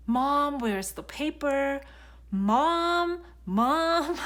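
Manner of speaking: high, loud imitation of kids calling; demanding child. Mom imitates her children needing her constantly, demanding.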